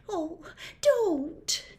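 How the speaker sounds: high pleading wail. Acted. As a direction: beg them to stop, pitched high. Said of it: "she wailed".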